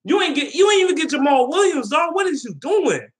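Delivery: angry shout. Retold or imitated. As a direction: yell it at the GM, fed up. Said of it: "I'm like".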